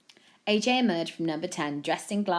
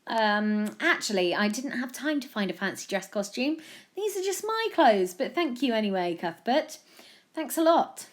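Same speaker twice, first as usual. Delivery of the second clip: higher-pitched field reporter; lightly voiced TV correspondent; news reporter, slightly put out; 7 semitones higher, 4 dB louder than usual. A narrator's reading. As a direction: recover and report brightly to the studio.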